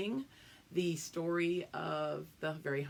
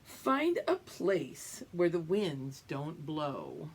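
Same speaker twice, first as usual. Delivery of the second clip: put-on reading to children. Reading picture book aloud.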